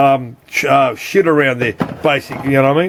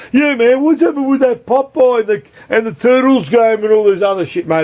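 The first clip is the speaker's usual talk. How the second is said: high mock voice of the boss asking; mimicking the boss; 8 semitones higher than usual. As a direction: pitch it up, playing the pushy boss. Voicing boss asking about boards.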